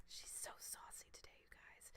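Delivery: teasing stage whisper aside. Acted. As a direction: whisper it to the chat. Aside about her partner.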